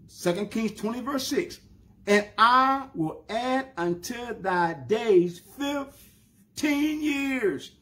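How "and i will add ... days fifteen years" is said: slow, drawn-out solemn reading; God's promise. Acted. Preacher reading God's promise to Hezekiah, slow and solemn. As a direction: stretch every word like a vow.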